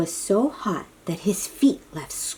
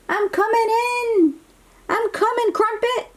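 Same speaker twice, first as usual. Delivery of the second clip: high, eager calling out; urgent rescue call; 14 semitones higher than usual. Acted. Ryan jumping in after the dragon, high and urgent. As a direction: hurry in to save your friend.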